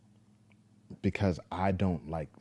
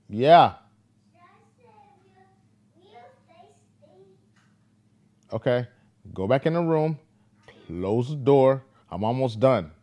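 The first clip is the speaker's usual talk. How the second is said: much higher, loud call to another room; 8 semitones higher, 10 dB louder than usual. Real, not acted. Father calls to his son in the other room, loud call.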